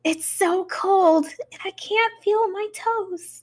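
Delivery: put-on high, slow, eerie little girl voice; creepy ghost girl. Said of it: "a creepy girl named Mimi".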